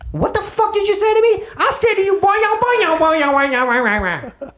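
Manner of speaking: very high, hammed-up outrage; cartoonish high-pitched argument; mock confrontation. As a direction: squawk back in comic indignation, tumbling into babble. Acted heated exchange with a local.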